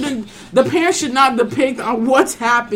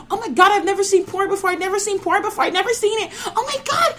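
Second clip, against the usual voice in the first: mock panic, much higher pitch; high panicked girl voice; 9 semitones higher than usual. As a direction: squeal it like a freaked-out girl.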